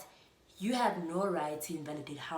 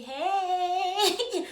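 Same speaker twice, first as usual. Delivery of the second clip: high, loud, exuberant hey; imitating mom's greeting; 12 semitones higher, 10 dB louder than usual. Mom greeting her at home, high loud imitation. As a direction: call out a big hello like her mom. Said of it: "That's how my mom is".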